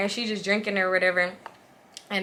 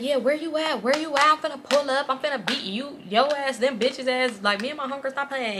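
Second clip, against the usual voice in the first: put-on threatening girlfriend; 5 semitones higher, 4 dB quieter than usual. Voicing girlfriend threatening on phone.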